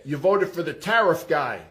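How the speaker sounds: ordinary talk, nothing marked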